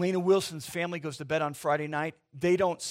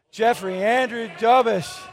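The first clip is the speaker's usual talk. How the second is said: loud full name; 5 semitones higher, 9 dB louder, slower than usual. Real, not acted. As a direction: say your name out loud with the congregation. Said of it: "One, two, three".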